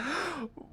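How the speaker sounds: high theatrical intake of breath; mock-amazed gasp; dramatic gasp. Host gears up to read a chat message, dramatic gasp.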